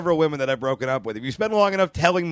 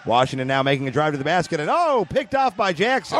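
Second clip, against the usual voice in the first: play-by-play call, rising on oh; sports commentator. Acted. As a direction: call it like live basketball. Mock play-by-play.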